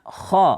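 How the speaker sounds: "kha" as a voiceless throat scrape in place of gha; wrong letter kha. Shown as a demonstration. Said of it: "Huruf gha jangan dibaca".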